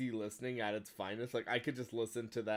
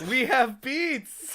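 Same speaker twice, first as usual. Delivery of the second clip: much higher, louder, slow squeal of the meme; mock meme shout; 12 semitones higher, 9 dB louder, much slower than usual. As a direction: belt it out like the video. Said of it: "I watched the Dick Miss".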